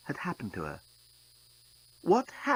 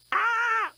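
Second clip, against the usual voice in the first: very high, loud scream; robot scream; 4 dB louder than usual. A narrator's reading. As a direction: shriek in alarm. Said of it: "screamed the desk bot".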